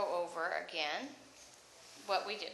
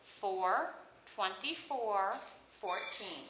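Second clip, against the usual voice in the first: slow dictation; much slower than usual. Shown as a demonstration. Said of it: "teacher dictates slowly".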